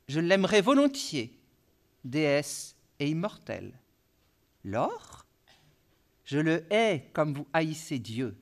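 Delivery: prose-poem dialogue with long pauses; poem reading. Acted. Lecturer reads L'étranger to the audience, measured poetic recitation.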